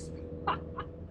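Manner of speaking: breathy giggle; high airy laugh of nerves; nervous laugh. Real, not acted. Nervous at the steep road.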